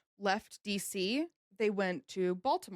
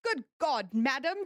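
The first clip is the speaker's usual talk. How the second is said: British admiral exclaiming, slightly raised pitch; British accent; 4 semitones higher than usual. Acted. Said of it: "I wanna do this in a British accent".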